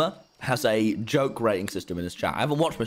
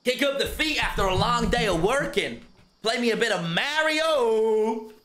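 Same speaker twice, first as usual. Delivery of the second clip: hammed-up American streamer voice, pitched higher; over-the-top American accent; fake American accent; 8 semitones higher than usual. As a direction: play a cartoonish American host. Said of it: "I need to be less British".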